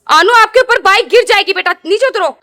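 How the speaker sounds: worried mother raising her voice at her son. Real, not acted. Child climbing on bike.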